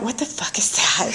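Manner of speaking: whispered startled thought; soft, breath-only acted aside; hushed bewilderment. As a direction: whisper it to yourself, baffled. Startled private thought in the car.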